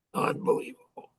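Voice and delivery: unbelievable, whispered in awe on a high breath; breathless whisper. Real, not acted. Marvelling at his amazing grandma, a hushed whisper. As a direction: let the word fall out as a hushed whisper.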